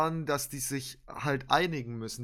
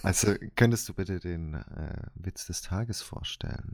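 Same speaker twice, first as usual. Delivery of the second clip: Ansagerstimme; 7 semitones lower than usual. Pretend. Kündigt Witz des Tages an.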